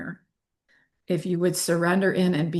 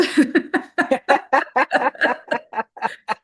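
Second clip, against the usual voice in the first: laugh; 7 semitones higher, 4 dB louder than usual. Real, not acted. Laughing at riding the rapids.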